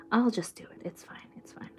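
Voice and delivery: barely audible, resigned whisper; whispered self-reassurance. Real, not acted. Streamer giving in to herself, whispered and fading. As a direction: whisper it to yourself, fading away.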